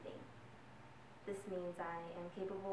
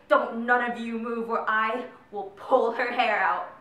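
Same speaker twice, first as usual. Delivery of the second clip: slow, much louder menacing threat in another voice; bully's threat; 2 semitones higher, 17 dB louder, slower than usual. Retold or imitated. Bully Max threatening Ella's friends, slow loud menace. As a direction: lean on each word like a threatening bully. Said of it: "I heard him say".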